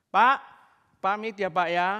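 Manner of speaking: loud, high call of farewell. Retold or imitated. Preacher calling goodbye to the pastor, loud call. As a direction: shout the goodbye across the yard.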